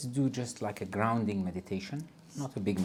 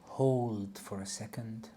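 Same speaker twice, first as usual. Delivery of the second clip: calm, soft meditation guide voice; guided meditation; 10 semitones higher, 6 dB quieter than usual. Acted. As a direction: ease them into a body scan. Leads a grounding meditation.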